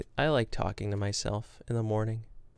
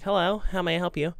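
phone greeting voice; 7 semitones higher than usual. Retold or imitated. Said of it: "I'm like".

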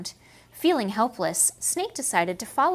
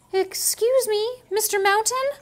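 timid snake; 11 semitones higher, 4 dB louder than usual. Acted. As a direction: ask the mountain shyly. Snake addresses the moose.

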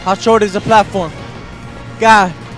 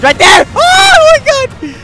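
panicked scream; 9 dB louder, slower than usual. Real, not acted. Gamer spotting danger right there, panicked scream. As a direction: shout rising to a high shriek. Spots danger, gets hit.

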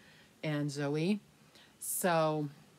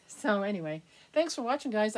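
normal talking voice

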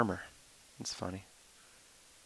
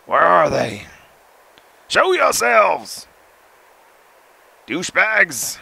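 loud, high yell at the hunters; shouting at hunters; 5 semitones higher, 8 dB louder, slower than usual. Real, not acted. Player yelling at unseen hunters, loud and high. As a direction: call them out, loud and high.

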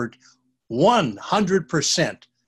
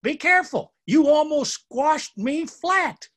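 tiny ant shouting; 12 semitones higher than usual. Acted. Little ant scolding the boys for almost squashing him. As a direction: scold them like a tiny upset insect. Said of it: "a voice shouting at them".

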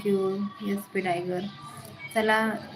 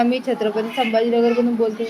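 ordinary talk

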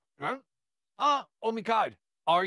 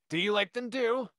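ordinary delivery

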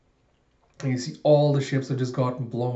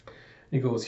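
ordinary delivery, nothing marked

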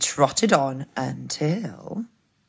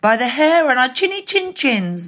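high, triumphant piglet voice; squeaky group catchphrase; little pigs; 7 semitones higher, 5 dB louder than usual. A narrator's reading. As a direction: chant it as relieved piggies. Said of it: "they said".

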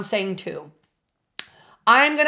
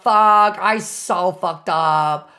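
usual speaking voice

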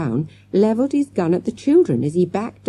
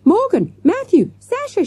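very high, urgent calls; shouted; 14 semitones higher, 3 dB louder than usual. A narrator's reading. Girl alerting classmates to the man, shouted. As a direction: raise the alarm, calling high to each friend. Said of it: "she shouted".

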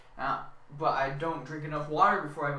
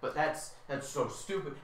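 usual speaking voice

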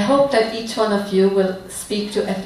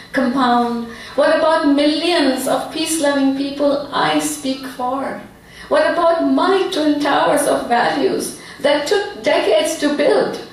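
measured phrases, each image set apart; heartfelt poem recitation; 6 semitones higher than usual. Acted. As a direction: recite your poem solemnly, with feeling. Resumes her poem.

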